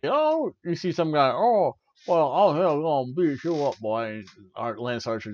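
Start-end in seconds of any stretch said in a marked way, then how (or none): 0.0-4.2 hillbilly mud wrestler. Retold or imitated. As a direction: taunt like a backyard brawler. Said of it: "he just, like".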